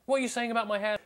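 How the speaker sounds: very high, loud squawk of offence; defensive. Retold or imitated. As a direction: shriek back defensively. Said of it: "defensive straight away".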